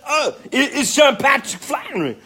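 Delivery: telling how they met, shouting like a thrilled fan; mock starstruck squeal. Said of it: "And I said".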